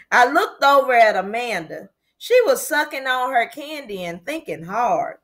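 ordinary delivery

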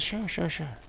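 lightly voiced wife brushing it off; wife's easy agreement. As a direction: toss off a light, airy okay like his wife. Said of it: "She says".

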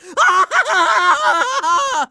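hammed-up triumphant cackle; long gloating villain laugh; evil villain laugh. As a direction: cackle like a cartoon bad guy. Villain gloats after threat.

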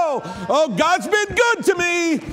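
high, jubilant preacher's shout; joyful crying out. Shown as a demonstration. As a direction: lift the voice in exultant praise. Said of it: "lift our voice and we cry out in joy".

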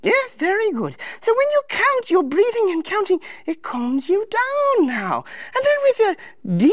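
lilting, gently concerned advisor voice; sing-song storybook helper; fussing counselor. Acted. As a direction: coo at a troubled little king.

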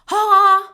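loud, high scornful bark of a laugh; Gülçin's gloating "Ha!". Retold or imitated. Friend mocking the speaker's lawsuit news with a loud scornful ha. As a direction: imitate a gloating snort at bad news. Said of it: "Gülçin böyle yaptı".

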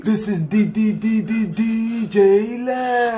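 slow, high stuttered DJ tag; stutter echo. Acted. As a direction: drag out the stuttered D. DJ stutter sign-off.

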